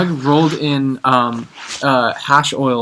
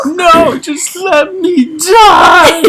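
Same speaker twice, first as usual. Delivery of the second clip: much higher, loud, very slow hammed-up wail; melodramatic wail; 8 dB louder, much slower than usual. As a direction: wail it like a tragic hero throwing himself in the sea. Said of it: "and I'd be like".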